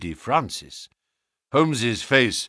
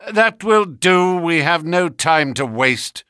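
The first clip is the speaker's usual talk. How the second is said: firm; 7 semitones higher, 5 dB louder than usual. A narrator's reading. Holmes to the bald man, cutting off his excuses, firm and loud. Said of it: "said my companion firmly".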